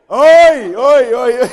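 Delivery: loud high laughing oi; mock oi cry. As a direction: shout oi oi in playful disbelief. Mocking exclamation.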